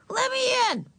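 high-pitched little Ramona scream. A narrator's reading. Ramona at the bathroom door from the hall, screaming high. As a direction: call out very high, demanding entry. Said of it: "screamed Ramona from the hall".

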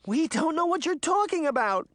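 high-pitched panicked protest; high, distressed child's cry. A narrator's reading. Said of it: "Daphne cried".

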